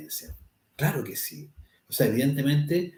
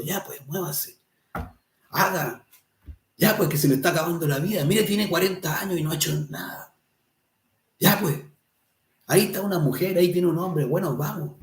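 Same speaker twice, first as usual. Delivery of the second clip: higher-pitched, impatient nudging; acted-out nagging, pitched up; impatient urging; 6 semitones higher than usual. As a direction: prod someone reluctant to get moving. Inner being pushing one on.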